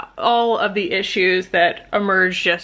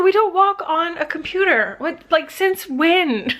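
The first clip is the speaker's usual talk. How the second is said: pet scolding; 10 semitones higher than usual. Real, not acted. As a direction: squeal at the cat to get off. Scolding cat on keyboard.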